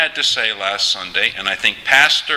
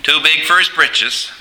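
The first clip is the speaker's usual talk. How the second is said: Newfoundland accent; 4 semitones higher than usual. Shown as a demonstration. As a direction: play two old Newfoundlanders chatting. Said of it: "Conversation between two Newfoundlanders".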